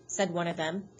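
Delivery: usual speaking voice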